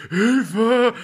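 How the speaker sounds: high panicked call for help; mock cry for help. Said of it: "Handyaufnahme mit".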